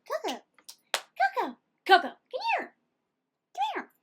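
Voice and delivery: high, rising calls for the dog; calling the dog. Real, not acted. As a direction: keep calling the dog to come over.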